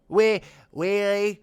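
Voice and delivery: high, loud falsetto; put-on girlish falsetto question; hammed-up "beautiful" high voice; mock beautiful voice. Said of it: "she asked in the most beautiful voice".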